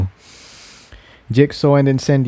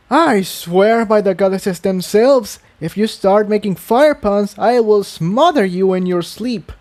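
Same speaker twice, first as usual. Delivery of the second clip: higher voice, mock threat over the pun; exasperated Tiptoe; 8 semitones higher than usual. Tiptoe warning Incendia off fire puns, exasperated and higher. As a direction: groan it out as a playful threat.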